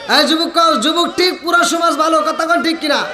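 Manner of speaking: slogan called out to an audience; rousing preacher's call; rallying the crowd. Real, not acted. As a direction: call it out, demanding agreement. Makes crowd repeat slogan.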